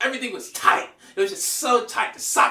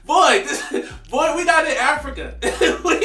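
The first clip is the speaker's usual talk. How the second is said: high, loud words through real laughter; laughing; 8 semitones higher, 7 dB louder than usual. Real, not acted. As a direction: explain it while cracking up. Laughing while retelling punchline.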